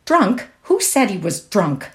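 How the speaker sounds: sharp, offended challenge; furious. A narrator's reading. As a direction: fire back in outrage at a sister. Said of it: "Laura turned furiously on Josie".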